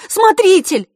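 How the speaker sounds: высокий громкий крик девочки; кричи, чтобы услышала трибуна; отчаянный крик. A narrator's reading. (отчаянный громкий крик)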